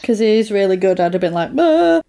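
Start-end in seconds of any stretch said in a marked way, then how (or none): 1.6-2.0 higher startled "ah"; little pretend cry of fright; mock scream. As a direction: imitate a startled yelp. Imagining own reaction to spider.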